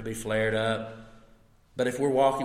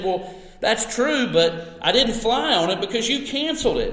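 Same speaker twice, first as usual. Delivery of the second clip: exasperated retort; 10 semitones higher, 6 dB louder than usual. Retold or imitated. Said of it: "he's feeling all of this anger".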